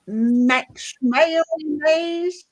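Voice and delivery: slow stretched Southern Max Mayonnaise; drawled Mayonnaise, jaw pushed out. Shown as a demonstration. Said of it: "There we go".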